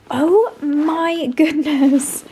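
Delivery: long, drawn-out awestruck oh my goodness; amazed gasp. Real, not acted. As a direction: savour every word in wonder. Amazed by the king size duvet.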